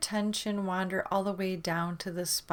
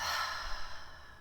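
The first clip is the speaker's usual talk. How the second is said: long exhale out through the mouth; voiceless, soft sighed-out breath; audible sigh; 5 dB quieter than usual. Shown as a demonstration. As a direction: demonstrate a releasing sigh. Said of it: "sigh it out the mouth".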